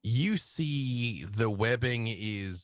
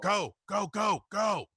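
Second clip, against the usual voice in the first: high shouted go; Jake shouting go; 9 semitones higher than usual. Acted. Jake shouts the players toward the ambulance, high urgent shout. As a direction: yell them on, high and urgent. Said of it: "He's like".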